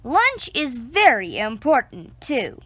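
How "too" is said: plain reading voice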